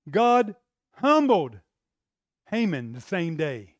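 drawn-out, loud on humbled; slow emphatic. Acted. Preacher pounding the point to the congregation slowly. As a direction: hammer each word home.

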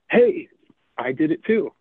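a high, bright burst of triumph; excited friend. Acted. A joke's tall friend opening his letter, bursting with excitement. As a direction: leap up on the hey, thrilled to win. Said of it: "They're all excited".

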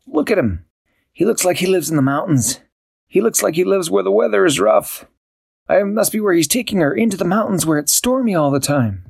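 high, worried cat voice; puzzled and upset; dismayed Barnaby. Acted. Barnaby in the empty room, high dismayed voice. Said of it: "Barnaby thought".

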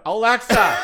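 high, loud mock summons; shrill-pitched, hammed-up shout of the name; mock call to Alexa. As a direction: call out to a smart speaker in jest, laughing. Mock calling to the device.

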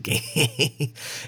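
imitating a goofy brainless cackle; quick mock laugh of a dim bully; mocking dopey laugh. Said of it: "he laughs like that constantly".

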